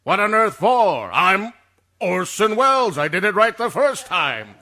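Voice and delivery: loud, high, pompous indignation; affronted star's outburst; outraged protest. Acted. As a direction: pull rank on the crew at full volume. Outraged at request for retakes.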